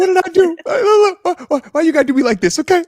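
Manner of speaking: high, squeaky mock-innocent protest. Teased co-host protesting his innocence, squeaky and high. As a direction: play wounded innocence, way up high.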